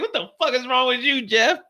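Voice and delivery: high, loud alarmed question; alarmed shout. Retold or imitated. Narrator demanding to know what's wrong, loud alarmed call. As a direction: demand an answer, worried.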